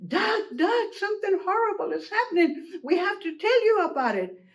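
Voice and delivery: high-pitched, breaking bad news; urgent alarm. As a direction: call out in storybook panic. Hen and rooster alert Duck.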